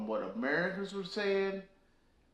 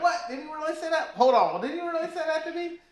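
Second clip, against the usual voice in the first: high, cracking voice of total shock; stunned disbelief; 12 semitones higher, faster than usual. Retold or imitated. Hearing a slur said to his face, shocked high disbelief. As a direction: go way up in pitch, like you can't believe your ears.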